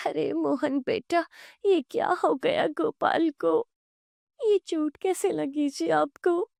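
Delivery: high-pitched, acted alarm; alarmed women. Family seeing Gopal's bandaged head, alarmed. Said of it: "दोनों घबरा जाती".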